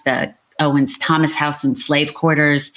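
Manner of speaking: ordinary talk, nothing marked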